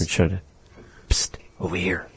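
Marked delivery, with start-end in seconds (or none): 1.1-2.1 hushed, whispery call; attention-getting psst. Pretend. Teacher mimics English way of getting attention, hushed whisper. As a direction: whisper it to discreetly catch someone's attention. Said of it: "get someone's attention".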